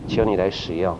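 low, subdued prayer to God; private prayer. Retold or imitated. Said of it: "我对神这样说".

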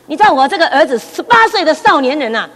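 usual speaking voice

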